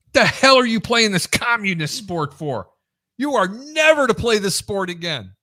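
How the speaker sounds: high, loud, forceful scolding; retold angry dressing-down; irate coach chewing out. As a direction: lay down the law as the coach. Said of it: "he's screaming at the top of his lung".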